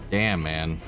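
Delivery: ordinary talk, nothing marked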